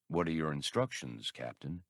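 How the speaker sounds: low, soft, slow robot drawl; measured droid drawl. A narrator's reading. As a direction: answer like a patient labor droid. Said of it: "In his measured drawl".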